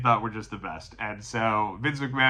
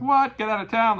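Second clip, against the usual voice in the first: much higher, louder astonished cry; excited disbelief; 11 semitones higher, 4 dB louder than usual. Retold or imitated. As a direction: react to a prize win in shock. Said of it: "They're all excited".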